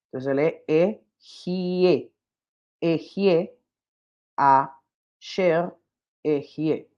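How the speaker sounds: "E, Jie" spelled out slowly, each syllable apart. Shown as a demonstration. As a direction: sound out "Jie" and "Sher" one by one. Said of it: "esos tres puntitos significa E".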